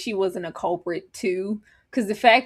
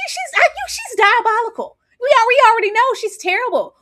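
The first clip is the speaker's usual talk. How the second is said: high laughing yell; laughing shout; 12 semitones higher, 6 dB louder than usual. Real, not acted. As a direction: shriek it through laughter. Laughing outburst at mother.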